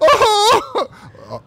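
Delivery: high, loud maniacal cackle; evil genius laugh. Real, not acted. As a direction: cackle like a mad scientist. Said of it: "That's my evil genius laugh".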